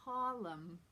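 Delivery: "Harlem" as HAW-lem, R left out; dropped R. Shown as a demonstration. Teacher models a New York word, dropped R. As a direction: leave the R out of "Harlem". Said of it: "no R in the word Harlem".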